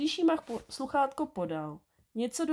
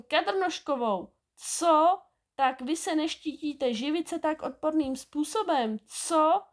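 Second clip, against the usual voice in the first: high, raised scolding with punched questions; indignant calling out; 7 semitones higher, 4 dB louder than usual. A narrator's reading. Angry neighbours accusing two thieves, high indignant scolding. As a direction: berate them, throwing out each sharp question. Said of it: "A všichni volali".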